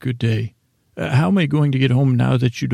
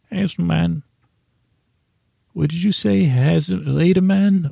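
cat voice; 5 semitones higher than usual. Pretend. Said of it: "say goodbye to Podman".

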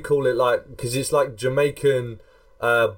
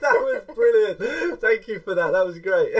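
laughing; 8 semitones higher than usual. Real, not acted. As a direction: praise through leftover laughter. Praising after laughing.

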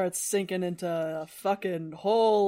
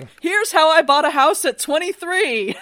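lightly voiced boaster, higher and louder; mock self-made boaster; 7 semitones higher, 9 dB louder than usual. Parodying Creosote as a rich heir giving house-buying advice, mock boastful. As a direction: brag loudly in mock how-to style.

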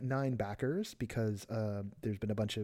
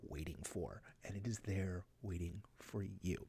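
soft unvoiced whisper; 5 semitones lower, 11 dB quieter than usual. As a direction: breathe it out in a whisper. End of ad read, whispered.

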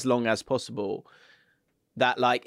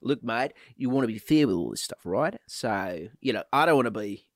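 put-on lightly done Australian accent, quick; a mild impression of Ange in Australian; hint of Australian, fast. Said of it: "I've lost my accent there".

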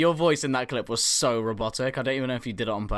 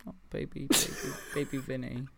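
mock baby talk; 7 dB quieter than usual. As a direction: coo at him like a hurt baby. Cooing over friend's sore knee.

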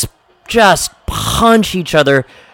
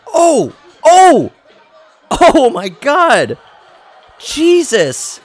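excited yelps; 9 semitones higher, much slower than usual. Real, not acted. Reacting to Drago's punches, excited shocked cries. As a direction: yelp as each punch lands. Watching a brutal boxing match.

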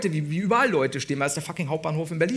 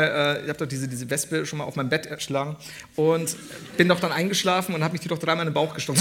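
ordinary talk, nothing marked

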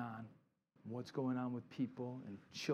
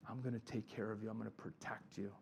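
gentle reassuring God; 5 semitones lower, 9 dB quieter than usual. Retold or imitated. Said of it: "God says".

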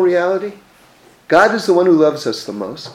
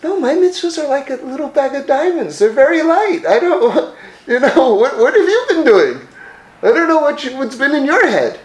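amused God; 8 semitones higher than usual. Retold or imitated. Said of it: "And God says".

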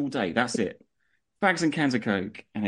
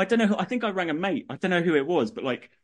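ordinary talk, nothing marked

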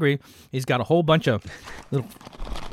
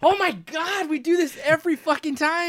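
much higher exasperated yelp; mock outrage; 13 semitones higher than usual. Real, not acted. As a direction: blow up in mock indignation. Mock outrage at poster remark.

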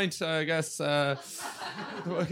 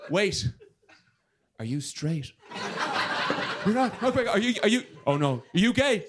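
mock panic; 5 semitones higher than usual. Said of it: "I'm like".

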